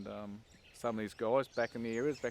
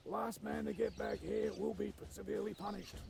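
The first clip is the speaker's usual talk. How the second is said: mock drill instructor; 10 semitones higher than usual. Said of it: "the P T O would turn around and go".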